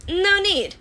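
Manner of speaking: loud, high brush-off; dismissive. Acted. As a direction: wave the worry away. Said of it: "May said dismissively".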